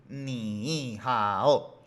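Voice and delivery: clumsy "ni hao", both syllables dip and rise; unchanged tones on each syllable of "ni hao"; two full third tones. Shown as a demonstration. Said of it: "se escucha feo decir".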